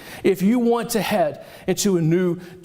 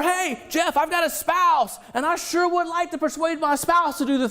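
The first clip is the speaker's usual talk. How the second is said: higher, eager audience voice; higher mock attendee voice; imitating attendee; 7 semitones higher than usual. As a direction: play a keen attendee asking. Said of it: "voicing an audience member".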